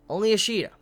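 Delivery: excited. Real, not acted. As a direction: savor the name in awe. Hyped praise repeated.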